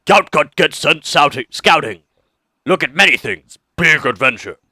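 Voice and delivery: slow, simple-minded ogre speech; dumb ogre voice. Acted. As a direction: read it like a friendly dim ogre. Reads simple-minded ogre's note.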